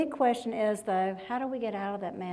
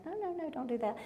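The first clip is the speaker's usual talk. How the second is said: soft, higher-pitched pleading; imitating husband's protest; 6 semitones higher, 9 dB quieter, faster than usual. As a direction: quietly mimic a husband objecting from the back, higher and under the breath. Imitating husband's muttered objection.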